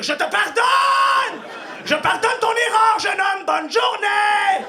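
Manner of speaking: high, loud slow ironic blessing; mock forgiving shout. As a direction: shout kindness sarcastically. Ironic imagined kind shout.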